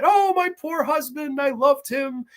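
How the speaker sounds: high, hammed-up fake sorrow; mock grief. Gold-digging wife fakes mourning for dead husband, hammed-up sobbing tone. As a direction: overplay a widow's pretend grief. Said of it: "they have to act sad".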